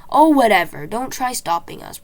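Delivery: loud scornful brush-off; boy storming off in defiance; dismissive. A narrator's reading. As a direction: wave them off angrily. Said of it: "storming on into the maze".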